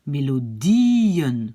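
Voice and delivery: "Mélodien" very slow, long swelling DIE, then a separate -en; prononciation modèle. Shown as a demonstration. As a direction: stretch the I of "Mélodien" up and down into -en. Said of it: "c'est pour la prononciation". (« Mélodien » très lent, I allongé puis -en)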